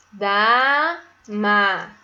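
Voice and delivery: DAMA stretched as "daa-MA", each syllable loud and full. Shown as a demonstration. Teacher sounding out a word for pupils, "Dama" in slow syllables. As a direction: split "Dama" into two drawn-out beats. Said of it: "Eu abri quantas vezes minha boca".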